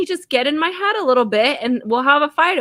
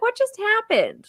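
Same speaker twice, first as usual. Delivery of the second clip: high, slow, baffled quote; mock bewilderment; 11 semitones higher, slower than usual. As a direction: act the stunned onlooker, pitched up. Voices baffled onlooker after bar fight.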